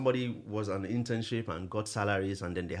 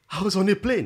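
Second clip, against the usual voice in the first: higher, much louder thrilled exclamation; retold excitement; 8 semitones higher, 10 dB louder than usual. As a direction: imitate a young man bursting with excitement. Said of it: "his excitement".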